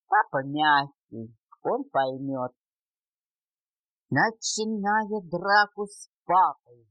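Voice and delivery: ordinary delivery, nothing marked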